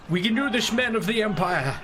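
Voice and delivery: rally the troops in a playful Empire voice; mock commander. Player rallying Empire soldiers before battle, mock-heroic.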